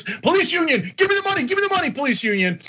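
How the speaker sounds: high-pitched mock eager pleading; mock begging. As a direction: parody a politician begging for cash. Mocking politicians begging the union.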